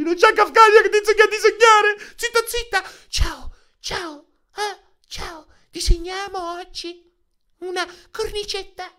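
very high, sweet mock streamer voice; squeaky sing-song parody of a gentle drawing host; mock Kafkania voice. Mocking Kafkania to chat, in a squeaky sugary voice. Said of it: "non ci sta Kafkania oggi".

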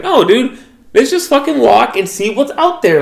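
high-pitched imitation of an urging friend; Kat. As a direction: act out an eager push to get going. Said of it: "But Kat was like".

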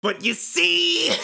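villain monologue voice. Acted. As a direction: drag out the villain's gloat. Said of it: "Kingdom Hearts level villain monologue in stupid voice".

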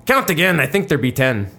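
put-on pirate accent punchline. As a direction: bellow it like an indignant pirate captain. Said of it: "pretty good pirate accent".

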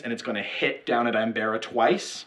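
normal talking voice

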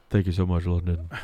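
low-key polite thank-you to the crowd; understated thanks. Retold or imitated. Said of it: "something like".

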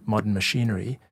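usual speaking voice

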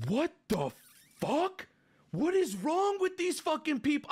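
high, rising cry of disbelief; outraged disbelief. Retold or imitated. Streamer reacting to kids hiding the mother's dating, outraged. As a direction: spit out your outrage at these people. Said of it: "And I'm like".

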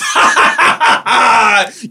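high, loud witchy cackle; cackling laughter. Acted. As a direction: cackle like a gleeful hag. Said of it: "you hear a cackling laughter".

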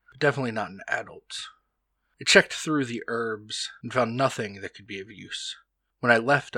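steady, hushed diary reading; journal reading. Acted. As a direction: read it like an old diary entry. Reads journal entry aloud.

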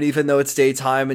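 usual speaking voice

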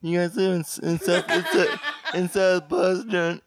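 slow, jaw forward, laughing through it; creepy slow jaw-forward voice, half laughing. Shown as a demonstration. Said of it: "So creepy".

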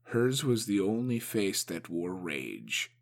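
ordinary delivery

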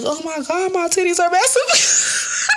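high squealing amazement, drawn out; amazed. Retold or imitated. Girl in seventh grade squealing at her new chest. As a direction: squeal it like a kid discovering something huge. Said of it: "And I was like".

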